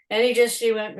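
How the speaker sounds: ordinary talk, nothing marked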